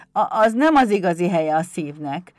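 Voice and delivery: loud, halting imp admission; kobold voice. Acted. Pumukli looks up at Éder, loud halting kobold voice. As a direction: admit it in a loud little goblin voice.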